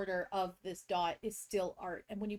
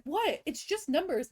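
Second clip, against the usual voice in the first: put-on innocent kid voice; 7 semitones higher, 4 dB louder, slower than usual. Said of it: "And he was like".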